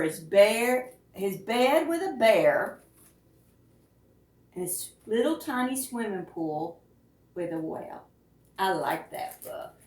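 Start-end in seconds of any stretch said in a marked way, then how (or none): none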